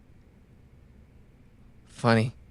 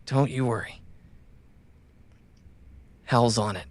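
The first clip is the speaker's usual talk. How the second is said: usual speaking voice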